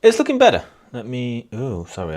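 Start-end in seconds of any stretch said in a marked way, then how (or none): 0.0-0.6 loud and high, mic turned up too far; blasting into a hot microphone. Real, not acted. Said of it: "I didn't mean to have my microphone up so loud".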